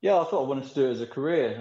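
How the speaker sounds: ordinary delivery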